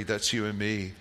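ordinary delivery

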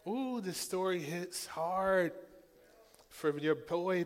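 ordinary delivery

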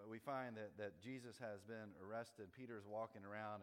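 usual speaking voice

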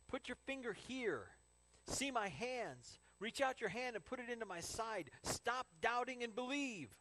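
high, slow, insistent urging; emphatic command. Acted. Jesus to doubting Thomas, emphatic and commanding. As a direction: press each command home.

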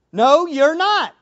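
hammed-up disciple scolding Jesus; mock Peter rebuke. Preacher playing Peter rebuking Jesus, mock scolding. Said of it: "Peter takes him aside and says".

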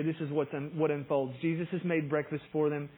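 normal talking voice

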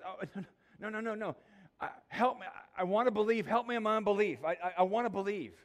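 high, loud, pleading cry of a desperate father; desperate plea. Retold or imitated. The father pleading with Jesus to heal his boy, loud desperate cry. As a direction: beg him to help your son, voice rising.

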